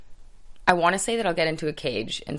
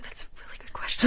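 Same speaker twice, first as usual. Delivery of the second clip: hushed, guilty aside; sheepish whisper; 3 dB quieter than usual. Retold or imitated. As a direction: whisper it like a confession. Retold reply to manager.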